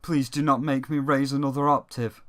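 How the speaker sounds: low, flat, affectless prince voice; mock deadpan game prince; monotone character impression. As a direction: say it like nothing could move you. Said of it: "That mildly annoys me".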